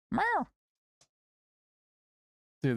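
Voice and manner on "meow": high, squeaky meow; cat meow. Pretend. Streamer meows at his cat Milo, high squeaky meow. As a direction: meow back at the cat like a kitten.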